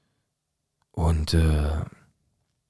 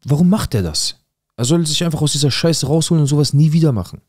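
retold exasperation, climbing higher in pitch; exasperated uncle; 6 semitones higher, 3 dB louder than usual. Uncle complains to the mother about the father, exasperated. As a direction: imitate an uncle fed up, building to a high-pitched plea.

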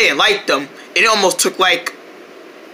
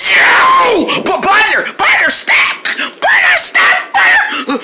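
shouting; 10 semitones higher, slower than usual. Acted. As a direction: yell after them, pitched up. Chasing fleeing friend.

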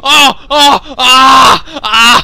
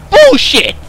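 high outburst; frustrated high-pitched curse; indignant yell; 8 semitones higher, 4 dB louder than usual. Real, not acted. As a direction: shout it in protest. Frustrated player yells.

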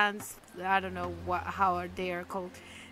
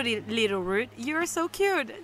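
usual speaking voice

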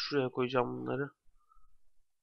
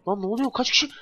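alarmed; 9 semitones higher, 5 dB louder than usual. Real, not acted. Player startled by a crowd of mobs, alarmed high yelp. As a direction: pitch jumps high in alarmed surprise.

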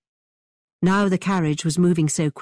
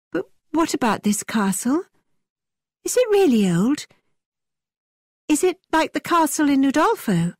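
higher and eager, full of wonder; eager, higher girl; 8 semitones higher than usual. A narrator's reading. Catherine eagerly asking Thorpe about the castle, higher and wondering. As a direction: ask about the castle with rising excitement.

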